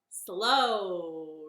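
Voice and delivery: ordinary delivery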